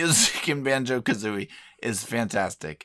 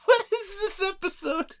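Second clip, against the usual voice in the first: very high laughing disbelief. Real, not acted. Co-host laughing in disbelief at the host's bit. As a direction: ask it through laughter.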